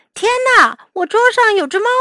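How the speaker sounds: very high, loud startled outburst; crying out. A narrator's reading. Walrus startled by a cat on the table, crying out high. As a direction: yelp it in shock. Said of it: "海象叫了起来".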